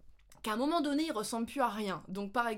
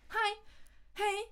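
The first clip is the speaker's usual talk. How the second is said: much higher hi, hey; quick squeaky greeting; 11 semitones higher, much slower than usual. Shown as a demonstration. Showing viewers the quick high Kiwi greeting. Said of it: "vous voulez lui dire bonjour".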